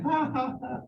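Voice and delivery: high jeering ha ha; imitating kids' mocking laugh; mocking laughter. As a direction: taunt the crying teacher with a laugh. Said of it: "they looked at the teacher crying".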